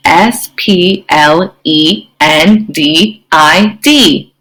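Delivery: slow, letter by letter; spelling out. Shown as a demonstration. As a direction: spell it out with a gap after each letter. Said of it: "I'm gonna spell the word".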